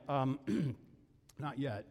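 embarrassed. Retold or imitated. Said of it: "And I'm like".

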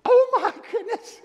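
very high, loud cry with a laugh; awed exclamation. Retold or imitated. Preacher voices John reading Revelation, laughing high awe. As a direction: gasp out the wonder. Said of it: "it's like".

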